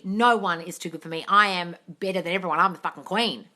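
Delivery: firm, self-assured declaration; emphatic, proud affirming; confident opposite affirmation. Shown as a demonstration. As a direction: state it with total self-belief. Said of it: "What is the affirmation opposite of that".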